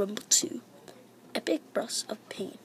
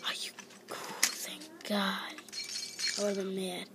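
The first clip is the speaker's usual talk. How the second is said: relieved whisper; whispered relief over a quiz answer; 3 semitones lower, 7 dB quieter than usual. Real, not acted. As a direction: breathe it out quietly with relief. Whispering in the library.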